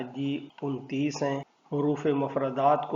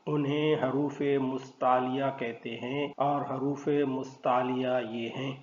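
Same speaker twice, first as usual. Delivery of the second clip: normal talking voice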